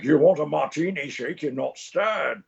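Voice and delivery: mock celebrity imitation; bad Connery impression. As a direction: overdo a fan's Scottish spy voice, drawing out the end. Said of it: "trying to do your voice".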